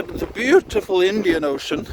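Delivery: normal talking voice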